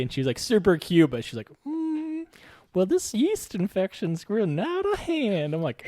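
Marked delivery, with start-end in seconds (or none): 1.6-5.5 put-on higher, slow, mild old lady voice; old Southern lady. Tiny old patient explains her yeast infection, higher and slow. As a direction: play the sweet little patient, unbothered.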